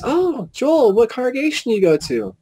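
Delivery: high-pitched imitation of a chatty lady; lady at the table. Lady greeting a new acquaintance, high imitated voice. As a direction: mimic her up high. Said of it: "She goes".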